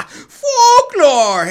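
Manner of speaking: loud laughing scoff. Acted. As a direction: laugh off the idea.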